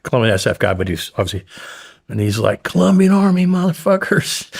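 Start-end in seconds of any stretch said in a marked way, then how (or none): 2.6-4.5 high, rowdy victory cheer. Retold or imitated. Colombian soldier cheering as the helicopter lifts off, rowdy. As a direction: whoop it up in triumph.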